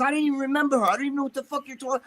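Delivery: high, rapid-fire retold protest; exasperated denial. Rick insisting to Ray he forgot her, retold exasperated protest. Acted self-defense in retold quarrel.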